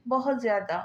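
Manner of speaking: ordinary delivery, nothing marked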